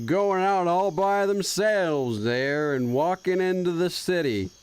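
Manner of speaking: very slow, stretched Texas drawl; bad fake Texas accent. Streamer trying out a fake Texas accent, drawn-out. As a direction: stretch out a fake Texan twang. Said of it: "a bad impersonation of a Texas person".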